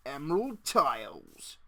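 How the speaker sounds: slow, hammed-up bad British accent. Player jokingly names the tiles in bad British accent. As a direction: put on a clumsy British accent for fun. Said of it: "really bad British accent".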